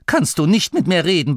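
high-pitched, offended protest; indignant complaint. Acted. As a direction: object to being bossed about. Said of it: "beschwerte er sich". (beleidigt, empört)

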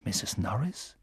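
hushed, questioning whisper. A narrator's reading. Ron peers through the darkness, whispering. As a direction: whisper it softly, rising at the end. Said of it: "flüsterte Ron".

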